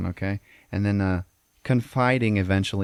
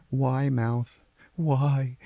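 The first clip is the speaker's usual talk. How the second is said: higher, mildly pleading voice; understated acted anguish, pitched up; tearful plea; 5 semitones higher, much slower than usual. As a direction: plead like a boy close to tears. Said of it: "he just starts crying".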